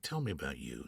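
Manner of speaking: low, soft, mildly curious; lightly voiced Jonathan. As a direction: quietly draw him out. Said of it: "he's like".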